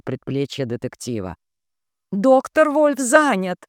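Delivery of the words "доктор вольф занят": high, indignant nurse protesting; shrill outrage, drawn out slowly. A narrator's reading. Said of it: "возмутилась".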